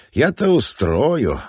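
slow, drawn-out words; drawled. Acted. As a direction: stretch each word out slowly. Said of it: "протянул он".

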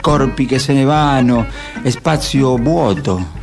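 normal talking voice

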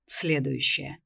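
low, soft purr. Acted. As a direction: purr the word. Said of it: "промурлыкал Мирон".

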